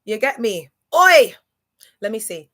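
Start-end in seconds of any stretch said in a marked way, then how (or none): 1.0-1.3 sudden loud high call; shouted oi. Real, not acted. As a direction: shout it out at the tune. Calling out to a song.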